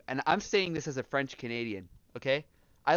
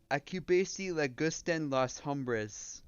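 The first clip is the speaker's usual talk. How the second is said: Spanish in a heavy English accent; slow, anglicized Spanish with English vowels; slower than usual. Real, not acted. Streamer trying out Spanish for chat, English accent. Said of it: "saying it with an English accent".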